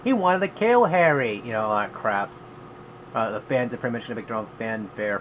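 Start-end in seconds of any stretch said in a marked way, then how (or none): 0.0-1.4 loud, high indignant outcry; outraged fan protest. Retold or imitated. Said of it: "people were like".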